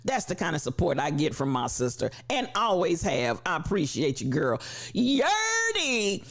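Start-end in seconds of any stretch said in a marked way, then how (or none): 4.9-6.2 high called-out name. Acted. Host greeting Yerdy in chat, called out. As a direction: ring the name out.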